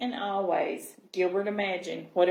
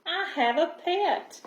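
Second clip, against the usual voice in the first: higher, delighted little fish; excited fish voice; 8 semitones higher than usual. A narrator's reading. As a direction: squeal out the joy of finally having a pet. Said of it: "Gilbert glubbed".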